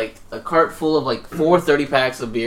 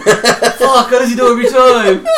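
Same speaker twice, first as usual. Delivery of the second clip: higher, louder through laughter; laughing mock complaint; 10 semitones higher, 10 dB louder, slower than usual. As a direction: complain while cracking up. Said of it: "voicing caught kid".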